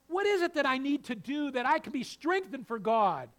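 loud, high, driving sermon voice; full-force preaching. Acted. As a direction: preach it out to the pews.

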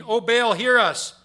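high, loud desperate cry to a god; calling out. Acted. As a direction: cry out to the idol. Prophets calling out to Baal.

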